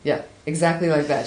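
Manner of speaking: usual speaking voice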